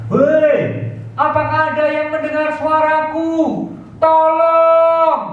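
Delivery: high, strained call for help; desperate cry for help. Acted. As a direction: shout up out of the ravine for anyone to hear. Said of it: "dia berteriak sekuat tenaga".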